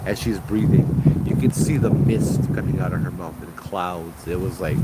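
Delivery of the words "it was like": ordinary delivery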